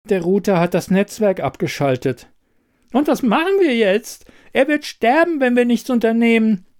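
zunehmend höher und lauter vor Angst; panischer Kühlschrank. A narrator's reading. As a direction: Panik steigt bis zum Sterben. Kühlschrank gerät in Panik. (Kühlschrank in Panik, immer höher und lauter)

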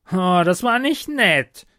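high, loud whine of complaint; plaintive kobold. Acted. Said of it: "klagte Sally". (klagender Kobold, hoch)

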